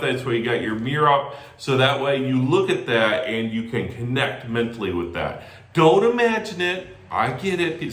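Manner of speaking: ordinary delivery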